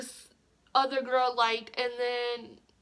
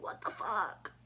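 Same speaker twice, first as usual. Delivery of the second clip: low, hushed disbelief; stunned; 4 semitones lower, 9 dB quieter, slower than usual. Retold or imitated. Seeing the boyfriend upstairs, hushed shock. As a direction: breathe it out in shock. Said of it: "and I was like".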